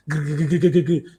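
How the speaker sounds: stuttering engine-starting noise; rolling growl of a pull-start motor; imitated generator sputter. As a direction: mimic a generator cranking up. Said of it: "when you pull this".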